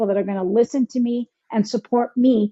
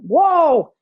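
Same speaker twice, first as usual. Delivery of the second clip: loud, drawn-out whoa, pitched up; retold whoa; 4 semitones higher, 6 dB louder than usual. Retelling being stuck in a loop, loud halt. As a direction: pull up short with a long whoa. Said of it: "and went".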